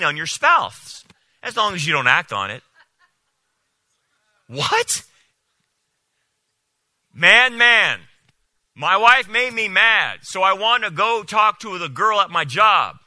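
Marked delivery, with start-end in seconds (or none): 4.5-5.0 a very high, startled "What?"; stunned by the pastor's advice; disbelieving what. Real, not acted. Reacting to the cheating quote. 7.1-13.0 a high, loud put-on voice of a whining husband; a hint of mocking the pastor's words to his flock. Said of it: "This is a pastor saying this".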